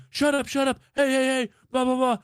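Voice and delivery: woman scolding barking dogs, high pitched; yelling at the dogs. Retold or imitated. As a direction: shout the dogs down. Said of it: "She's like".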